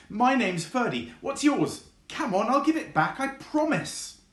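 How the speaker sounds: very high and eager, bouncing with excitement; excited fox. Acted. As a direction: beg to play, squeaky with glee. Fox introducing himself eagerly.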